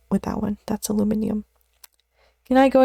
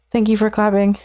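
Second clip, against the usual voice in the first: ordinary delivery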